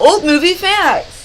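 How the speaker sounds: sing-song title callout; announcer voice. Acted. Second half of segment jingle.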